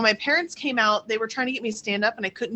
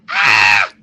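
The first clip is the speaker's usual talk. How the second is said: shriek; 13 semitones lower, 11 dB louder than usual. Retold or imitated. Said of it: "everything was like".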